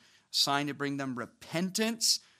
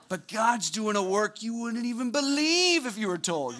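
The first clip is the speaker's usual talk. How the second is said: mock comforting friend; 6 semitones higher, 4 dB louder than usual. Mock pep talk to heartbroken student.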